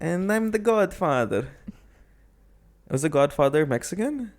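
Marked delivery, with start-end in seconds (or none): 0.0-1.5 slow, high mock Italian accent; badly faked Italian accent, drawn out; butchered Italian accent. Storyteller hams up lost driver as godfather, butchered Italian accent. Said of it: "I don't wanna do the accent".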